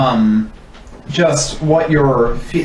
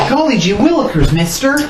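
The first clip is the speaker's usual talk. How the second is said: put-on high, bright gee-whiz kid; hammed-up old-timey kid; wholesome 1950s kid; 9 semitones higher than usual. Wholesome kid bit continued.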